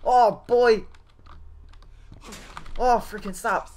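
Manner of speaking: loud, high panicked cry. Real, not acted. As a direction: cry out in fear. Chased in horror game.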